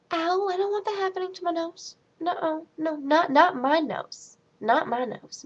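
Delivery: high, whimpering protest; mock dread. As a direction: plead in a small high voice, easing down. Acted dread at surgery video.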